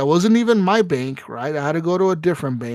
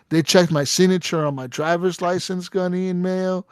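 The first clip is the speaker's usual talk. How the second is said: ordinary delivery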